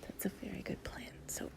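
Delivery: soft whisper. Acted. Lying close to a partner, drifting off, in a soft whisper.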